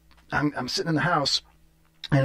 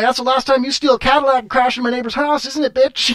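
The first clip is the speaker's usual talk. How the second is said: high, loud gloating jeer; gloating taunt; 10 semitones higher, 5 dB louder than usual. Retold or imitated. Gloating at the car thief in custody, loud high taunt. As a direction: rub it in, loud and gleeful.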